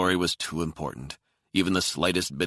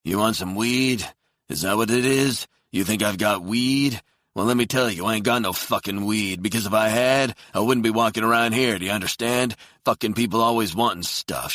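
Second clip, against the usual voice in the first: angry rant; 6 semitones higher, 7 dB louder than usual. Acted. Homeless man ranting at Nathan about weed, loud and furious. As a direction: lay into him, furious.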